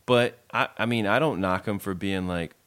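ordinary talk

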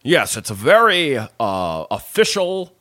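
high, loud stage-actor parody; enunciated, official voice. Retold or imitated. Podcast host mimics the actor's stage persona, enunciated official voice. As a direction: enunciate grandly like an old radio player. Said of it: "Everything is enunciated".

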